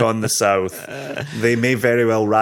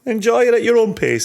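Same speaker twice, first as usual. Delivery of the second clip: much higher, playful; 11 semitones higher, 5 dB louder, faster than usual. Real, not acted. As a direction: toss it off playfully. Joking advice.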